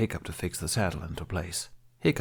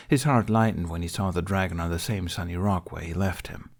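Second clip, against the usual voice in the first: ordinary delivery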